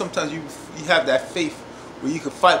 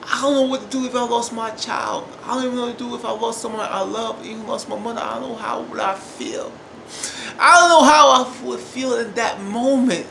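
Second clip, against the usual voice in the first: acted grief; 5 semitones higher than usual. Voicing pain of losing someone.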